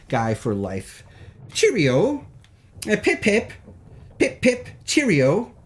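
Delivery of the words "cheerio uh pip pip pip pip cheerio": a hint of a posh English gent, cheerio tossed up high; playful jokey English toff voice, barely put on; lightly mock British accent. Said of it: "my impression of those".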